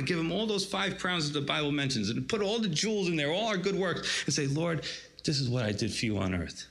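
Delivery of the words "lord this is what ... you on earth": lower, hushed offering to the Lord; a humble whisper of worship, lightly done; reverent prayer. Pretend. Believer prays offering crowns to Jesus.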